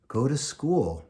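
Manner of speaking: ordinary delivery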